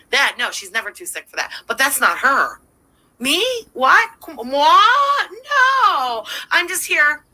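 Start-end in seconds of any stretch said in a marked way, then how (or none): 3.2-7.2 hammed-up high-pitched denial, loud stretched no; exaggerated wounded-innocent impersonation; mock innocence. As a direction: play shocked innocent, then fake sick. Acts out streamer's feigned innocence.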